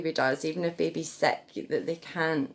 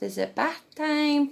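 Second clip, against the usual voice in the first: much higher cheerful question; baby talk; 10 semitones higher than usual. Shown as a demonstration. Mum taking her baby to the bath, high baby talk. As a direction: ask the baby brightly, way up high. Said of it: "before they go in the bath".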